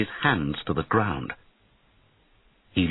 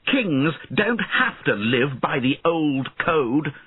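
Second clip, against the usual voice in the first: slow, raised defiant retort; moderately shouted defiance, higher pitch; defiant shout back; 7 semitones higher, slower than usual. A narrator's reading. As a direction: answer back as the new king. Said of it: "Einan shouted back".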